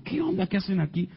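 high-pitched startled query; retold surprise, voice jumping up; surprised question. Man finding his friends right behind him, surprised.

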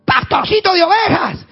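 high, loud furious shout; giant's threat. Retold or imitated. Goliath threatening the shepherd boy, furious shout.

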